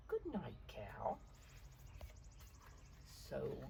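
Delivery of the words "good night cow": whispered goodnight; bedtime whisper. Acted. Blue saying goodnight to Cow at bedtime, whispered. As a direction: hush it like the child is falling asleep. Bedtime goodbye to sleepy friends.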